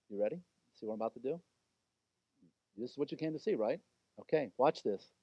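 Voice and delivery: low, soft, knowing challenge to the Pharisees; low taunting aside. Acted. Jesus quietly daring the watching Pharisees, low and soft. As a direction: dare them quietly, almost under your breath.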